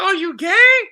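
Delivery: high, loud punchline question; retold shout of comic surprise; mock surprised outburst. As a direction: blurt it out loudly, playing it for laughs. Punchline of retold coming-out talk.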